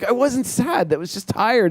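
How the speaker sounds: high, lightly protesting Keanu; mock protest. Director imitating Keanu denying he looked sad. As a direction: jokingly squeak out the denial. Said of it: "He's like".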